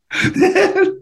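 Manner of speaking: a burst of high laughter at his own story; high real laugh. Real, not acted.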